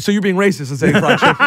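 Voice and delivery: teasing through laughter; laughing. Real, not acted. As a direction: laugh while accusing him.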